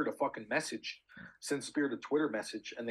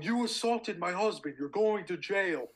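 higher-pitched, drawn-out mock scream; retold screaming; 6 semitones higher, slower than usual. Man imitating the wife accusing him at the airport, mock screaming. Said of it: "starts screaming that I assaulted her husband".

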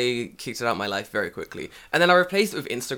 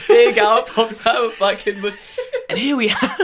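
high and loud through laughter; laughing much higher and louder; 10 semitones higher, 10 dB louder than usual. Real, not acted. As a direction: crack up while speaking. Laughing at nature documentary joke.

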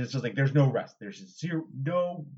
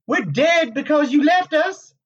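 much higher, pained apparition voice; mournful reproach; 11 dB louder, slower than usual. A narrator's reading. Egwene's apparition blaming Rand for leaving, mournful high voice. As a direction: accuse him in a high lament. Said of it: "she said sadly".

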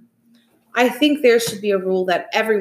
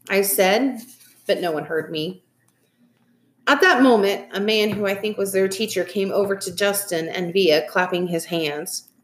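ordinary delivery

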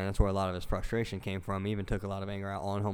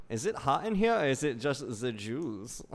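flamboyant Hitler; 5 semitones higher than usual. Pretend. Voicing a flamboyant Hitler bit.

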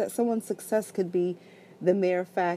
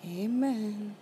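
sleepy, slurred, drowsy voice; 3 semitones higher, 4 dB quieter than usual. Real, not acted. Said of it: "she sounds like a drunk".

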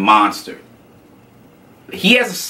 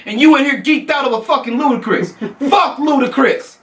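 jealous rant; 12 semitones higher than usual. Retold or imitated. Bo yelling at Ashley in the food court, jealous high shout. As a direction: yell at her like a jealous boyfriend. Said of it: "Bo had, like".

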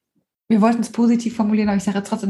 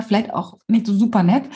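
ordinary talk

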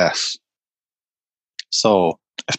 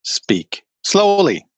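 high, slow, drawn-out "Speak slowly"; mock tour-guide coaching; slow instruction; 10 semitones higher, much slower than usual. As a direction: stretch it out like a trainer giving an order. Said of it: "we were told".

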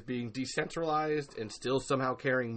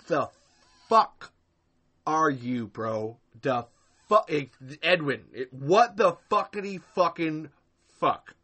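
louder exasperated swearing; incredulous outburst; 3 semitones higher, 8 dB louder than usual. Real, not acted. Host reacting to Glenn's words, loud incredulous swearing. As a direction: spit out the disbelief.

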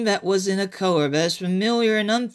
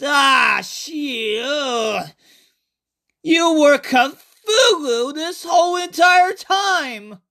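high, loud panicked cry; horrified realization; 8 semitones higher, 3 dB louder, much slower than usual. Acted. Narrator realizing the alien is Cthulhu, panicked high cry. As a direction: shriek it as the horror dawns.

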